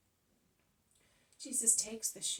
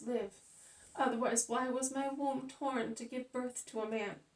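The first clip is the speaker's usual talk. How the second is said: higher and louder, crying out; anguished lament; 5 semitones higher, 5 dB louder than usual. Acted. Mother to the crowd, crying out in grief. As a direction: rail against the injustice through grief. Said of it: "who continues".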